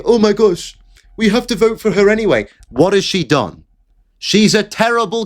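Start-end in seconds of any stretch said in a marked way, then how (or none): 0.0-2.5 high, fast whiny mock voice of an emotional voter; hammed-up panicked fan. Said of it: "and saying".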